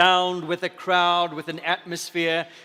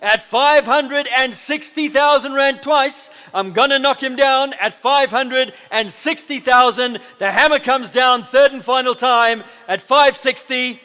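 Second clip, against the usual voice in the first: higher and louder, coaxing the last bid; excited auctioneer push; 7 semitones higher, 10 dB louder than usual. Real, not acted. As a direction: build the pressure, then slam it sold. Auctioneer pushing for final bid.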